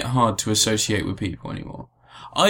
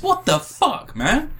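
exasperated outburst; 12 semitones higher, slower than usual. Real, not acted. Ranting about the referendum.

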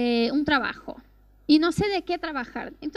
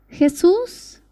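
retold first prayer; 2 semitones higher, 9 dB louder than usual. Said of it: "cerró los ojos y dijo".

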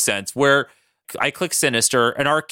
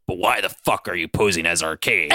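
low, gruff challenge; Logan; 10 semitones lower than usual. Acted. Logan questions Sinister about the disguise, low and blunt. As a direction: drop the pitch for a blunt demand. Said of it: "And Logan goes".

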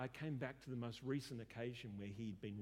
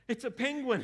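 sudden loud, high-pitched cry; mock outburst; 10 semitones higher, 14 dB louder than usual. Upset client reveals the cuddly toy, loud mock outburst. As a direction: burst out with it, much louder and higher.

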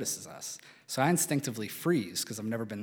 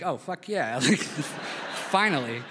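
put-on kid-like excitement; 4 semitones higher, 3 dB louder than usual. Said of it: "the kid in me was like".

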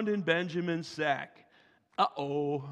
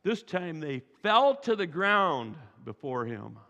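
usual speaking voice

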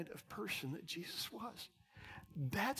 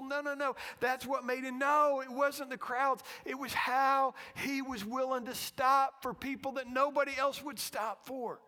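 raised, insistent preacher's correction; forceful preaching; 7 semitones higher, 6 dB louder than usual. Real, not acted. Preacher correcting congregation about success, forceful preaching. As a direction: insist on it, pitched up.

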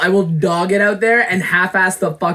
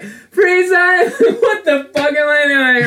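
high, loud sales yell breaking into laughter; re-enacted hysterical laughing yell; cracking up; 11 semitones higher, 4 dB louder, much slower than usual. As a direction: shout the pitch, then dissolve into helpless giggles. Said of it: "remember cracking myself up while doing it".

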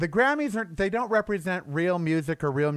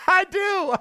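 high mock admission; 4 dB louder than usual. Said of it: "She goes".

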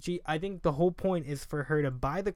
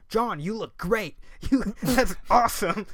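mom; 7 semitones higher, 11 dB louder, slower than usual. Retold or imitated. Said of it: "she's like".

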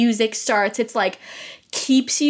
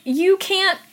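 higher-pitched, hint of a parent laying down a rule; lightly voiced scolding parent; 9 semitones higher than usual. Parent punishing Lucy with a consequence, lightly mock scolding.

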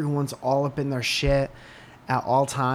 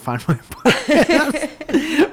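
laughing; 6 dB louder than usual. Real, not acted. Laughing at own subscriber count.

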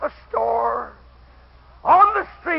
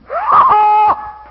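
loud whooping laugh, high and bursting; a preacher's loud, high laugh; joyful shout of laughter; 13 dB louder than usual. Real, not acted. Congregation hears a story punchline.

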